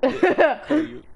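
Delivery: words burped out; burping. Real, not acted. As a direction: burp through the words. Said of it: "that was a burp".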